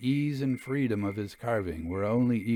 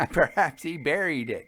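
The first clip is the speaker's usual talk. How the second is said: light laugh running into the words; chuckle, then a teasing suggestion; 5 semitones higher than usual. A narrator's reading. Jasper teasing his friend about buried gold, laughing. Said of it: "suggested Jasper, laughing".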